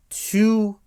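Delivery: two punched high and loud; stress on two. Real, not acted. Explaining the Smash Up premise, punching two high and loud. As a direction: hit the word two hard.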